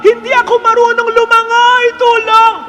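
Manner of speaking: high, loud panicked cries of a drowning woman; frantic high shouts for help; screaming for help. Retold or imitated. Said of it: "Kaya sumisigaw siya".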